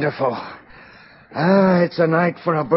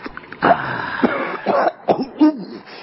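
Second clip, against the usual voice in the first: harsh choking cough on strong gin; coughing fit; 9 semitones higher than usual. Real, not acted. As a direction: cough hard after a burning swallow. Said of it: "strong stuff".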